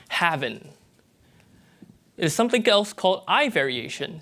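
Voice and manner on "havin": "haven't" said as havin', dropping the g; having with in'. Shown as a demonstration. Lecturer demonstrates Southern "having" as havin'. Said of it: "speaker B says".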